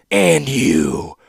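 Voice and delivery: low, loud rounding roar. A narrator's reading. Warlord rounding on his daughter, roaring. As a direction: turn on her with force. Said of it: "roared the warlord".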